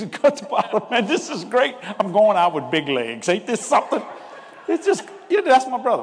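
loud, high, laughing exclamations; delighted outburst through laughter; laughing, gleeful retelling. Real, not acted. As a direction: barely hold back laughter as you crow it. Laughing through brother's gleeful quote.

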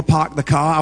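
attempted Maine accent with dropped R's. Shown as a demonstration. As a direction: say it like a Mainer, "pahk the cah". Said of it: "dropping my R's".

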